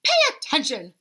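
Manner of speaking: a touch of a cross teacher; snapping. Acted. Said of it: "snaps Maestro".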